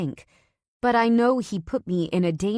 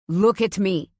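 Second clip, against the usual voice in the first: louder commanding yell; husband yelling to make her look; 3 semitones higher, 7 dB louder than usual. Acted. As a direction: bark it to get her attention. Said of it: "he yells".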